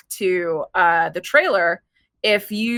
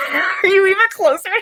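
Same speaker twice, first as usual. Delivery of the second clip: laughing; 14 semitones higher than usual. Real, not acted. Laughing at leaning in.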